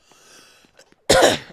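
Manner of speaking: sharp loud clearing of the throat. Real, not acted. Streamer loudly clearing throat before continuing.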